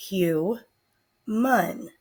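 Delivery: "hu, mun" said as two separate syllables; syllable split. Shown as a demonstration. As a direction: pause between "hu" and "mun". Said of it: "I will break it into syllables".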